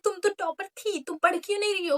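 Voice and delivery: sarcastic imitation of preachy elders; mocking nagging adults. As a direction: parody the people who scold. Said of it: "कहेंगे कि".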